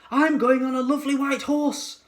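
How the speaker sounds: put-on high excited child's voice; eager kid claiming a horse; excited child cry. A narrator's reading. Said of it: "cried another".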